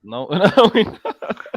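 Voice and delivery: laughing no, pushed up high, the last words breathy; giggling no through the noise; laughing denial. Real, not acted. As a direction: laugh it off in disbelief. Laughing denial mid-argument.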